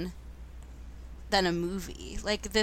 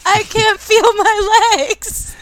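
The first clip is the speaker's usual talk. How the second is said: much higher and louder, slow anguished cry; shouting while wounded; 14 semitones higher, 12 dB louder, much slower than usual. Retold or imitated. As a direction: wail it like a hurt kid on the ground. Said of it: "the kid in Can't Hardly Wait who goes".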